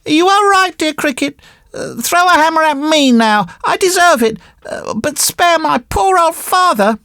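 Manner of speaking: very high puppet voice, pleading; penitent puppet boy. Acted. Puppet boy pleads for his father, high and penitent. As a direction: beg forgiveness in a high puppet voice.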